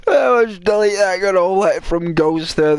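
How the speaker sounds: high, loud mock customer service agent; hammed-up dismissive customer service voice; dismissive advisor. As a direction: brush it off like a bored call-centre worker. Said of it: "customer service advisor".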